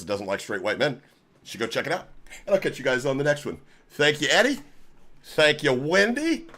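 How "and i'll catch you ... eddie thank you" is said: normal talking voice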